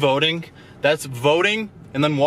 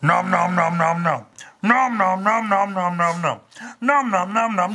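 mock chewing noises, higher; playful nom nom; 5 semitones higher, slower than usual. Voicing the dog chewing the crocodile toy, playful nom noms.

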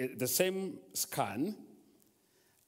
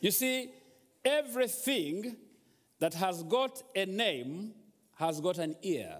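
raised proclaiming preacher voice; a preacher proclaiming a truth; preaching; 6 semitones higher than usual. Acted. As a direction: declare it to the congregation.

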